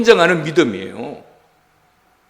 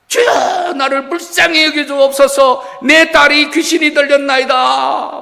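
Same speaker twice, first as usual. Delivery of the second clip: high, loud desperate plea; pleading cry; 6 semitones higher, 4 dB louder, slower than usual. Acted. Canaanite woman crying out to Jesus for her daughter, loud pleading. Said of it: "큰 소리로 고함을 지르는 거예요".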